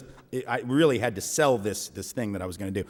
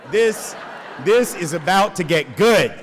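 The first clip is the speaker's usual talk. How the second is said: high, loud and slow with a laugh; hooting committee members sure a showdown is coming; gleeful committee; 6 semitones higher, 6 dB louder than usual. Retold or imitated. Eager committee relishing the fight, loud gleeful glee. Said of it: "they are just like".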